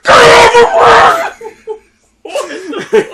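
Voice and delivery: drawn-out high furious scream; angry little boy. Retold or imitated. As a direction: scream it like a kid's instant tantrum. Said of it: "this boy gets angry instantly".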